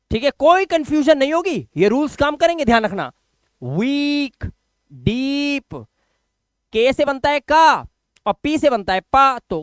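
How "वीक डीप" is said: वीक, डीप with the ee held long; drawn-out वीक, then डीप; long-vowel demo. Shown as a demonstration. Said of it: "अब कैसे पढ़ेंगे".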